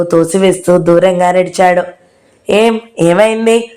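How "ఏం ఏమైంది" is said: a touch of hurt, slow; mildly wounded, asked slowly; hurt, sulky question. A narrator's reading. Radhika, rebuffed by Nandu, asks with mild hurt. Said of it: "చిన్నబుచ్చుకుంటూ అడిగింది".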